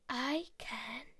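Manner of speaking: soft, low, sly whisper; sly spider. Acted. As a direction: whisper it like a spider about to pounce. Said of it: "quite a sly one for Spider".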